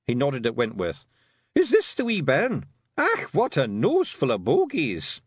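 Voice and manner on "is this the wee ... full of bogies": raised gruff little Scots pixie, pleased with himself; boastful wee Feegle, a bit louder; Rob Anybody's Scots voice. A narrator's reading. Rob Anybody boasting to Tiffany after the fight, Scots pixie voice. Rob's voice.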